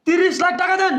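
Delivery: high-pitched, shouted demand; loud demand. Acted. Son-in-law demanding thirty lakh from father-in-law, shouting high. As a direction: raise your voice sharply and demand payment. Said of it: "তুই বড় গলায় কথা কস".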